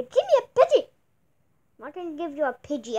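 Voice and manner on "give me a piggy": high, loud mimic of a bratty child; mocking kid voice. Mocking the kid's demand.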